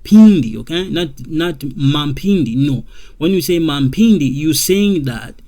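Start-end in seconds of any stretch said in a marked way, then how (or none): 0.0-0.6 Pindi loud and high, with an E-sounding i; correct Pindi. Shown as a demonstration. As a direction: say Pindi firmly, keeping the M silent. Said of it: "make this become Pindi".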